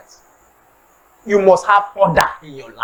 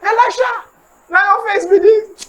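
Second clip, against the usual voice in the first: excited greeting; 8 semitones higher, slower than usual. Retold or imitated. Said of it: "Tony say".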